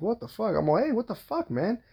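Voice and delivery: high-pitched indignant challenge, held back in volume; triggered. Retold or imitated. Father confronting the guy who knocked his daughter down, triggered. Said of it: "I'm fucking triggered".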